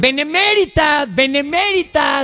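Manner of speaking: drawn-out high mocking benemérita, benemérita; mocking repeat. Host mocks the deputy's word, slow and high. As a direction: stretch benemérita out slowly, high and scornful. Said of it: "¿Nunca oíste hablar del benemérito".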